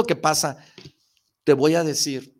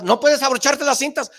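higher and louder put-down; louder mocking shout; 8 semitones higher, 6 dB louder than usual. Repeating the shoe-tying put-down to yourself, louder. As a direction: push the put-down even harder. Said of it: "y tú te sacabas".

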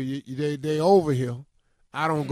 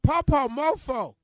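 put-on toddler voice; 10 semitones higher, 5 dB louder than usual. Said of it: "Noah pointed at me and say".

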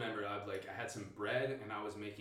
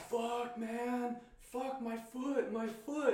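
high, drawn-out agonized wail; imitated pained cry; 14 semitones higher, 3 dB louder, much slower than usual. Friend who skinned his foot, imitated pained wail. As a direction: wail like someone badly hurt.

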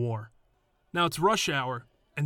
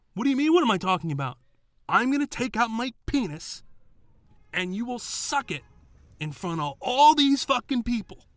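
higher, louder, fast unhinged threat; psychotic rant; 10 semitones higher, 5 dB louder than usual. Retold or imitated. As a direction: go off like a maniac. Doorman escalates the quarrel.

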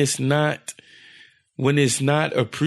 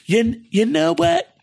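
much higher, slow, in a put-on voice for his date; mock offended date; 12 semitones higher than usual. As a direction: squeak it out slowly like the offended girl. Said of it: "she goes".

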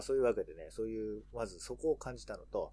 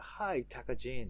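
put-on slow, louder American-accented タカジン; 2 semitones higher, 5 dB louder, slower than usual. Imagining Americans greeting the entertainer, slow American accent. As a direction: say タカジン like an American, stretched like "magazine". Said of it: "アメリカ人とかが".

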